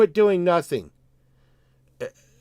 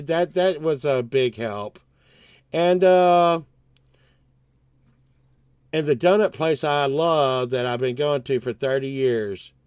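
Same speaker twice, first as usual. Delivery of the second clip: ordinary talk, nothing marked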